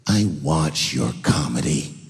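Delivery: low, slow disapproving lady voice; stern old lady. Retold or imitated. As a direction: drag it out like a judgmental old woman.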